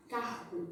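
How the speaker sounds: "carro" with an h-like R; normal R. Shown as a demonstration. Teacher showing parents her own accent, normal R. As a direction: say "carro" with the R from the throat, like h. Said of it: "O R normal".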